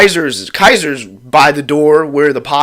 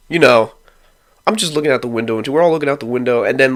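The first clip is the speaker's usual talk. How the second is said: ordinary talk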